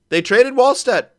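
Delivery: can't believe the trade; shocked disbelief. Real, not acted. Discovers goalie was traded.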